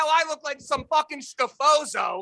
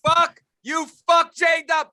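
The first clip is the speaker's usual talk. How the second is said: higher, louder joking yell; mock shout; 6 semitones higher, 5 dB louder, much slower than usual. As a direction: bark it at them for laughs. Mock yelling at friend.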